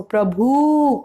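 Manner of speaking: प्रभु with the final oo drawn out; long u in प्रभु. Shown as a demonstration. Teacher shows students' mistake, प्रभु with long oo. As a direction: stretch the end of प्रभु into a long oo. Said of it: "बड़ू की मात्रा".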